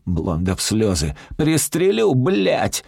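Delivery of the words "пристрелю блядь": high, insistent cursing yell; yelled threat. A narrator's reading. Robber with crying hostage yells his threat. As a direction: repeat the threat with a swear, pitched high. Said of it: "ору".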